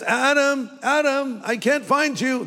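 mock searching call. Preacher playing a God who cannot find Adam, hammed-up calling. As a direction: play the clueless seeker, calling out.

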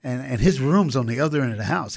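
ordinary talk

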